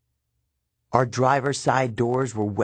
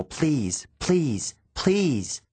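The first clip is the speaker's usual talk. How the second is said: each "please" higher, more urgent; rising pleading; 4 semitones higher, much slower than usual. A narrator's reading. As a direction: build the begging up. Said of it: "begging another mile".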